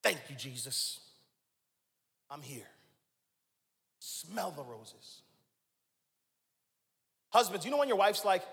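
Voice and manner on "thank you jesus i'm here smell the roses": soft, hushed, grateful; quiet thankful pause. Retold or imitated. Man pausing on a walk to thank Jesus, hushed. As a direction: pause and breathe it softly. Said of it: "you stop".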